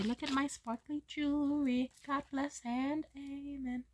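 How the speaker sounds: slow, prim church-lady piety; mock pious. Replacing bad words, a slow mock-saintly blessing. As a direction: pretend to be sweet and holy. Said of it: "Instead, I will say".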